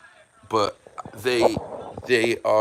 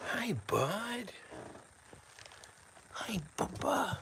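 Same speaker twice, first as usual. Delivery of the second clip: high soft whisper; whispering to a pet; 13 semitones higher, 11 dB quieter, much slower than usual. Real, not acted. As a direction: coo softly to the cat. Cooing to the cat.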